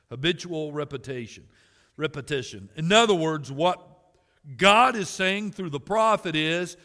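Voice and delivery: ordinary talk, nothing marked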